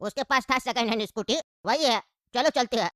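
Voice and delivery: put-on low, easygoing old uncle. Uncle explains the scooter.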